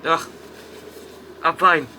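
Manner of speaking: ordinary talk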